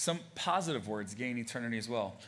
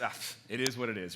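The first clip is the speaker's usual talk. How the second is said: lower, brushed-off it is what it is; dismissive shrug; 4 semitones lower than usual. Retold or imitated. Answering a questioner with a shrug, dismissive. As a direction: wave the question away. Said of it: "Someone asks".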